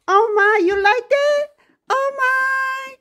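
put-on pet baby talk. Owner asking Gilbert about his toy, cooing baby talk. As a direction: gush over him like a toddler.

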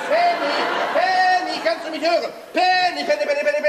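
loud, high yelling at the sickbed; yelling at the fainted girl until scolded for it; bellowing a name. Acted. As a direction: bawl her name to wake her. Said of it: "Brüllen Sie das arme Mädchen nicht so an".

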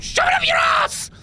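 very high angry yell; angry outburst. Real, not acted. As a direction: blurt it out furious. Outburst at game enemy.

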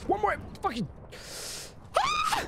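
very high strained yell into a scream; frustrated scream. Real, not acted. Gamer screaming as the kill slips away. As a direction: let it rip in frustration.

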